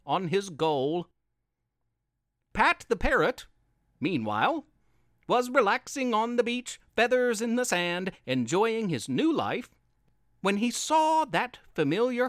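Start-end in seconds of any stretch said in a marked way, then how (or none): none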